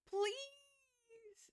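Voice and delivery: ordinary delivery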